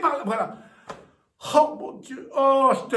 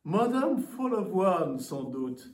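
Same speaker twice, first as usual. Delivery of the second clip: haughty aristocratic french; 9 semitones lower, 5 dB quieter, slower than usual. Acted. Visitor greets the hostess.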